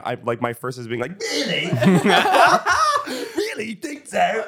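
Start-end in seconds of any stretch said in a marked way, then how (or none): 1.2-4.4 high, laughing mock disbelief; mock incredulous squeak; squeaky laughing reply. Mimics own overreaction, laughing.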